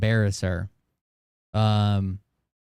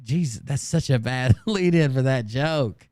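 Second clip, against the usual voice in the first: ordinary talk, nothing marked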